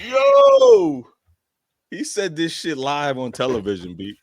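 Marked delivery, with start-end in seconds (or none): 0.1-1.0 high, loud yelp of disbelief; real shocked shout; shocked yell. Real, not acted. Shocked reaction to clip.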